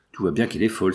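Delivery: ordinary delivery, nothing marked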